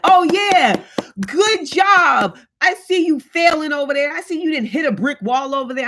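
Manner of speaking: high-pitched sarcastic congratulations, easing off toward the end; mock cheering. False friend to someone failing, mock cheering. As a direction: play a gleeful false friend, pitched well up.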